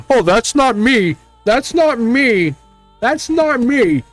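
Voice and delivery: mocking denial. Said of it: "I don't wanna hear from my comments".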